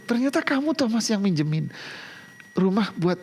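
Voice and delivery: imitating a grateful wife, light and high; happy wife. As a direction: mimic her pleased surprise. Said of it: "Dia tahu".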